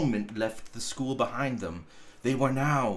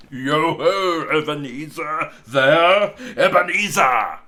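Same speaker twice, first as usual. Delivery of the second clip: booming, much louder, rich and fat-voiced; rich jovial call; 9 dB louder than usual. A narrator's reading. Fezziwig calling his apprentice across the warehouse, loud jovial boom. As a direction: call out like a big-bellied, merry old master. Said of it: "called out in a comfortable, oily, rich, fat, jovial voice".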